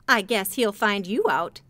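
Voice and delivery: usual speaking voice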